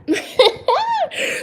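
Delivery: sudden high squealing laugh; bright surprised laugh at her own joke; high burst of laughter. Real, not acted.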